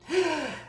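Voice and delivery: big relieved exhale; demonstrated sigh; sigh of relief. Shown as a demonstration. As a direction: let the breath go after hanging up. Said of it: "and then you breathe".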